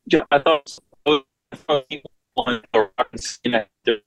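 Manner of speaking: choppy phone line; cutting in and out over the connection; breaking up on a call. Real, not acted. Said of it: "Breaking up a little bit".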